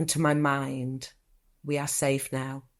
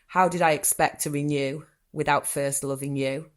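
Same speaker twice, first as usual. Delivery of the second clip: ordinary talk, nothing marked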